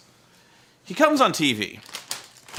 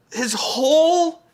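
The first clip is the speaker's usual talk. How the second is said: higher, strained half-laugh through the rant; laughing in exasperation; 8 semitones higher than usual. Real, not acted. Griping to viewers about Eric Young's gimmick, exasperated laughing. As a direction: laugh in disbelief while venting.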